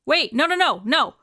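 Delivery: high, loud stream of protest; acted mounting panic; panicking. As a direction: frantically plead for them to stop. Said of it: "he kinda starts panicking".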